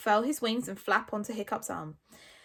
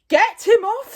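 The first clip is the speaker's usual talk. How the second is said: loud, high-pitched shout; full-volume outraged command; Stoic; 11 semitones higher, 13 dB louder, slower than usual. A narrator's reading. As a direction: shout the order in alarm. Stoic attacked by the dragon.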